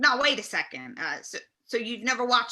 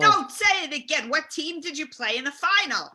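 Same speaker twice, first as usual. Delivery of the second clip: raised, impatient shout; loud and annoyed; 5 semitones higher, 4 dB louder than usual. Acted. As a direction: bark at the caller. Host scolds caller.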